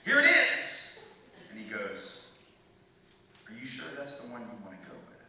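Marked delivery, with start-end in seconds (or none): 0.0-1.0 sudden high, loud blurt; frantic relief. Retold or imitated. Nervous driver offering the real license, frantic loud blurt. 3.5-5.2 softer, quick questioning; lightly voiced cop, quietly checking; knowing police officer. Cop asks about the chosen license, quiet and knowing.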